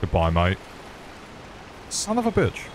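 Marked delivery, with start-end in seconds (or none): 1.9-2.7 high-pitched exasperated curse. Real, not acted. Gamer cursing at a game setback, high exasperated yelp. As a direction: curse leaping way up high, then dropping.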